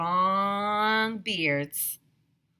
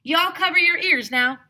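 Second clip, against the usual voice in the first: higher, louder southern holler; 11 semitones higher, 10 dB louder than usual. A narrator's reading. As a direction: warn the kids across the playground. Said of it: "Mister Burke hollered".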